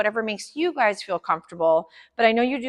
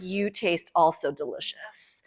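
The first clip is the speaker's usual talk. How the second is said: slow, softer goofy dog voice; dog's inner voice; 3 semitones lower, 5 dB quieter, slower than usual. Pretend. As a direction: voice the licking dog, soft and slow. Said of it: "He's like".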